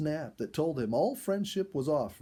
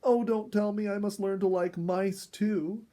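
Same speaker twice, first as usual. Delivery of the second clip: moaning; 8 semitones higher, 4 dB louder than usual. A narrator's reading. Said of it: "Dorothy moaned".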